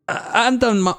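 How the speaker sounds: high, put-on goblin voice; stammering high-pitched creature. Trying out a voice for goblin.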